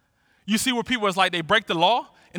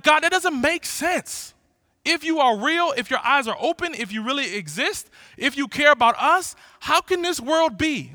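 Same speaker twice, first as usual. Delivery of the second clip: anguished plea to God; 6 semitones higher, 5 dB louder than usual. Retold or imitated. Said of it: "He says, like".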